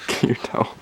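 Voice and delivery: laughing through the words; breathless laugh while speaking. Real, not acted. Friend soaked after head dunk.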